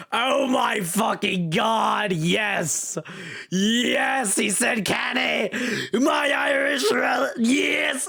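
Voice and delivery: high, ecstatic yelling, words drawn out; elated shouting. Real, not acted. Player thrilled the character speaks in dialect, elated shout.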